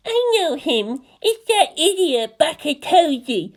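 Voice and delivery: put-on high, slow, scornful little girl voice; disapproving little girl. A narrator's reading. Little girl squints up at Toji, high scornful voice. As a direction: sneer it like a prim little girl. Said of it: "She squinted up at him and said".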